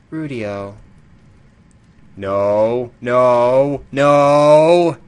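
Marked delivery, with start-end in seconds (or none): 2.2-5.0 loud protest, getting louder each time; rising alarmed shout. Real, not acted. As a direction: yell no, building to a full shout. Player shouts at dark screen.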